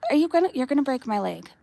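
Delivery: ordinary talk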